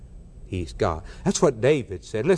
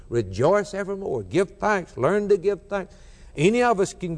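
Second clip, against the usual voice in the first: higher, rising exhortation from the pulpit; fervent preaching; 4 semitones higher than usual. Acted. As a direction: lift the psalm up like a call to praise. Preaching at full force.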